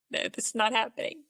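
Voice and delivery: usual speaking voice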